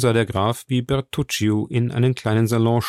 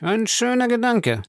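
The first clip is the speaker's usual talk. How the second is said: high-pitched, offhand remark; shrugging dismissal; 12 semitones higher than usual. A narrator's reading. Danglars answers about a planned Paris marriage, shrugging it off. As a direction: toss it off with a shrug. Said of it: "die Achseln zuckend".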